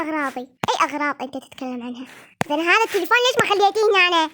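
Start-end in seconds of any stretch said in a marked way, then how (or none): 2.5-4.3 fast, loud, higher complaint; angry doll dad. Acted. Father scolds mother over the left phone, angry and fast. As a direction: snap at the wife in a put-on dad voice.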